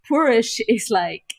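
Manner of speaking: usual speaking voice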